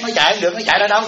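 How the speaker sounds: normal talking voice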